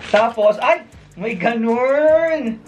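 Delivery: high, slow gasp of surprise; surprised gush. Real, not acted. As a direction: caught off guard by the find. Surprised by new item.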